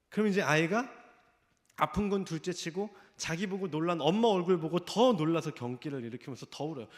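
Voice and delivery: ordinary delivery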